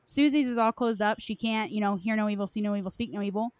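normal talking voice

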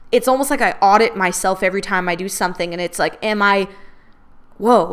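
ordinary delivery

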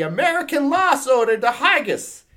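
high, loud mock-Scottish accent; attempted Scottish accent. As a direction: put on a rough go at Scottish. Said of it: "Can you do a better accent".

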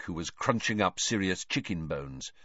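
usual speaking voice

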